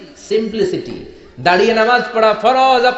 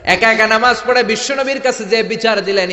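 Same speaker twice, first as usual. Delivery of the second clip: usual speaking voice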